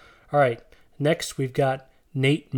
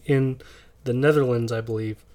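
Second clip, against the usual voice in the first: usual speaking voice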